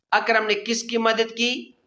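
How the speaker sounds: normal talking voice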